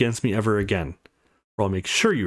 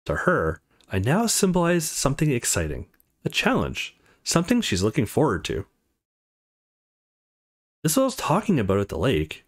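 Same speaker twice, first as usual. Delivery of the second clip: ordinary talk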